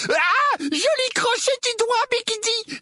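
very high, stretched-out crowing voice; cheering gang boy. Acted. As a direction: crow loudly for your gang leader. Gang boy cheers his leader. (cri de petite brute admirative, très aigu)

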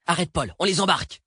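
high, insistent command; urgent order. Acted. Edmé orders the driver to pick up fugitives, urgent command. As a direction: call out for an immediate stop.

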